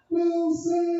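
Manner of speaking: high desperate call out; mock castaway yell; Castaway Wilson cry. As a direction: cry out for a lost friend falling away. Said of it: "out the fucking plane like".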